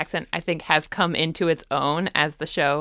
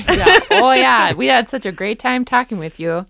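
louder, higher, hammed-up Minnesota accent; strong Minnesota accent; 4 semitones higher, 5 dB louder than usual. Host signing off in strong accents, hammed-up Minnesota. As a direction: play up a big, cheery Minnesota accent. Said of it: "do this show in really strong accents".

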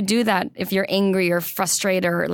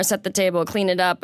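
ordinary talk, nothing marked

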